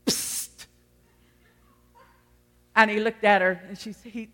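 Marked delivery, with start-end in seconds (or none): 0.1-0.7 parrot's attention-getting psst; short voiceless psst from the bird; sly psst. Acted. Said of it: "and the bird went".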